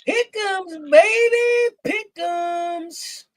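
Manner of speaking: high, loud hype call; hyped shout. Real, not acted. Hyped shout of pick 'ems.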